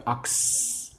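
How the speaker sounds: "Ox-" with a long, hissing S at the end; stressed S sound. Shown as a demonstration. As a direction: hold the final S of "Ox-". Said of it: "make sure you get the S sound".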